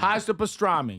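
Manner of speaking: hammed-up New Yorker; mock New York accent. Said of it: "ordering my food that way".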